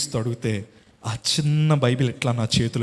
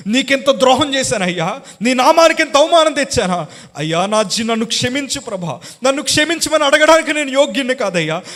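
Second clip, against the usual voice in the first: higher, louder broken plea for mercy; repentant weeping prayer; 11 semitones higher, 7 dB louder than usual. Retold or imitated. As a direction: beg forgiveness, sobbing in remorse. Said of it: "గుండెలు బాదుకొని ఏడుస్తుంటే". (పశ్చాత్తాపంతో ఏడుస్తూ మొర)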